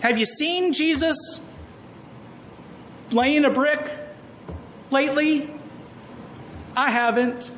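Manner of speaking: lightly mimicked fretting church member; doubting churchgoer. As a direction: voice the worried congregation, lightly.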